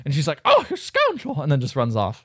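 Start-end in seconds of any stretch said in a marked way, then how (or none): 0.4-1.4 high, playful mock outrage; mock indignation. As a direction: act out a scandalized heroine, pitched way up. Acted indignant reply to a kiss.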